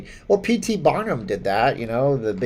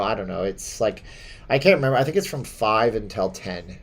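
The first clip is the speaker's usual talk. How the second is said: usual speaking voice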